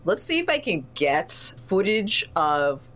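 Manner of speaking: normal talking voice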